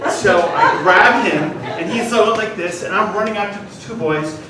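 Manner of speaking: ordinary delivery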